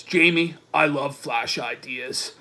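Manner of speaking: low, soft lover voice; hushed mock-romantic reading; mock suitor. Voicing Dick's romantic text.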